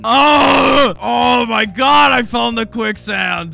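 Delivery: loud, high annoyed wail; pained scream. Acted. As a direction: howl it out, slow then easing off. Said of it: "You sounded like you were in pain".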